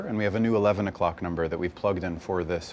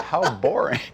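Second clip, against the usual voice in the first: mock dismay; 5 semitones higher, 5 dB louder than usual. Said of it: "I thought".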